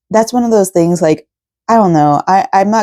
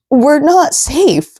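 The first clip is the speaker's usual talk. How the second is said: crying; 8 semitones higher, much slower than usual. Real, not acted. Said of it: "I'm gonna keep on crying".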